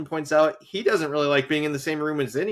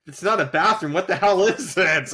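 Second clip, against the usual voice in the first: raised, louder, mock-baffled reaction; 5 semitones higher, 5 dB louder than usual. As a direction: act out confusion at a silly sign. Acted baffled reaction to sign.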